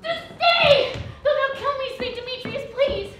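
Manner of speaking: loud, pleading cry after him; desperate plea. Acted. Helena begging Demetrius not to leave, loud plea. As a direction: beg him loudly to stay.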